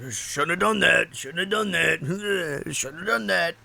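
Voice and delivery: slow, odd sing-song muttering; mock muttering. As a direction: imitate a man mocking voices only he hears, slowly. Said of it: "like, mocking them".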